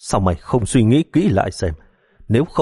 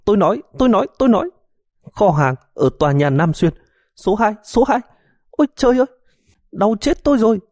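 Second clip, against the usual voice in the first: shrieking confession, far higher; wailing in agony; 13 semitones higher, faster than usual. Acted. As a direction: howl it out through the pain. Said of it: "vội kêu gào thảm thiết".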